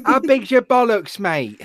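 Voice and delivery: hammed-up English lad, starting high; English slang in a put-on accent; mock English accent. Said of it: "made fun of an English person for their accent".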